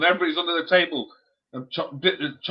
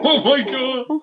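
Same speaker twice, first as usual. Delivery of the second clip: high surprise; 8 semitones higher, much slower than usual. Real, not acted. Reacting to the pet.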